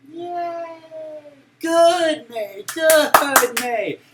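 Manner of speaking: high, loud delighted praise; excited cheering. Real, not acted. Teacher praising May for a right answer, excited cheer.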